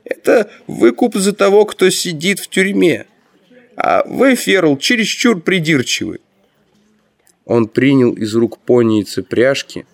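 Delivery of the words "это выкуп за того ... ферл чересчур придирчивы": high-pitched character voice; Grand Master's voice. A narrator's reading. Grand Master excuses the ransom to Ferl, high character voice. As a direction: play the ruler in a raised, high voice. Magister's acted voice, overruling Ferl.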